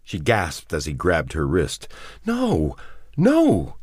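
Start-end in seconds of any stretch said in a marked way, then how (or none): 2.2-3.8 high, alarmed denial; quick denial. A narrator's reading. Woman grabbed by the wrist denies leaving, alarmed. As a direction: protest at once, high-pitched. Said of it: "she said quickly".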